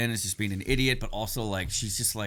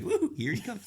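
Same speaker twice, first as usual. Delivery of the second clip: Grogu voice; 12 semitones higher, 4 dB quieter than usual. Retold or imitated. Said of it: "he's like".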